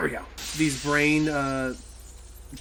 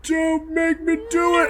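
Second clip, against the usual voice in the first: pleading; 14 semitones higher, 9 dB louder, slower than usual. Real, not acted. Pleading with game hazard.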